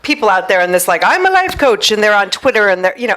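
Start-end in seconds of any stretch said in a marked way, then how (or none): 1.0-1.9 mock self-important. Mocking online coaches.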